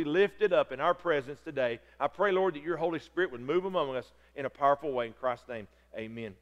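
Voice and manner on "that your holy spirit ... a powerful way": ordinary talk, nothing marked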